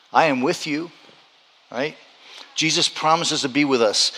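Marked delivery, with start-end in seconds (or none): none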